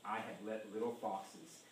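ordinary talk

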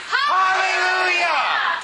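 high, drawn-out rallying cry of praise; shout. Real, not acted. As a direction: lead the room in a bigger shout. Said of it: "we're gonna shout hallelujah".

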